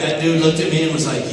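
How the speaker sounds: ordinary talk, nothing marked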